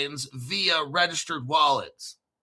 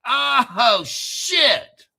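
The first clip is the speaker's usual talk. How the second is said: rising, louder oh shit; excited surprise; 6 semitones higher, 5 dB louder than usual. Acted. Vlogger reacting to crypto news, excited surprise. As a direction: pitch shoots up on shit.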